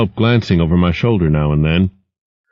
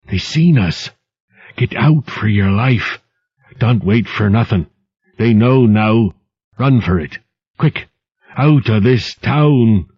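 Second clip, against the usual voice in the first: frightened urging; 3 semitones higher, slower than usual. Acted. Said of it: "his voice came back".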